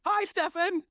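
very high bright hello; cheery greeting. Pretend. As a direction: call out the hello gleefully. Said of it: "I'll go".

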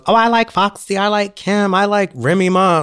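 mock them in a bright high whine; mocking other girls. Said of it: "All the other girls".

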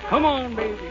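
normal talking voice

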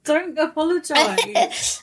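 slow, gentle words through a laugh; drawn-out laughing comfort; laughing reassurance. Real, not acted. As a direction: laugh it off kindly so she needn't be sorry. Host reassures guest who is crying.

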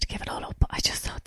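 hushed, hopeless whisper; despairing whisper. Retold or imitated. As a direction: breathe it out like a secret defeat. Confessing despair before Edinburgh.

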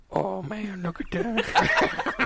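half-hearted Southern drawl, very slow, trailing off; slow, exhaled try at a Southern accent; attempted Southern accent. Shown as a demonstration. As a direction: drag out a weak Southern accent. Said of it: "Ask [name] to do a Southern accent".

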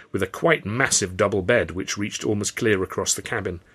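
ordinary delivery

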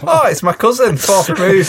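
high-pitched, gleeful put-on discovery; mock excitement. Teasing friend who finds distant relatives' graves, mock excited. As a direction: squeal it in pretend delight.

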